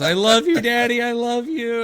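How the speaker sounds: high, loud, overjoyed thanks; gushing daughter. Retold or imitated. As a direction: pour out gratitude like a thrilled kid. Daughter bursts in thanking dad.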